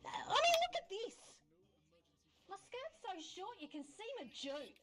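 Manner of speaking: complaining dream figure. Acted. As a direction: protest in a high, fading voice. Dream character complains, urges waking.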